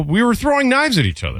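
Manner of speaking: high-pitched retold self-defence; indignant protest. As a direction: protest like a kid who got told on. Retold self-defence to tattled-on parent.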